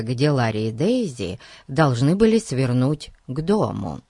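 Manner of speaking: ordinary delivery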